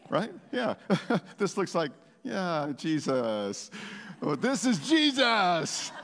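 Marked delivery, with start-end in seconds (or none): 2.2-3.7 muted, falling, half-hearted cheer; unenthusiastic praise. Shown as a demonstration. Preacher showing praise without the yell, half-hearted. 4.2-5.9 slow, raised, stretched-out cheer; exuberant praise. Shown as a demonstration. Preacher showing praise that yells for Jesus, exuberant.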